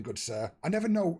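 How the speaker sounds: usual speaking voice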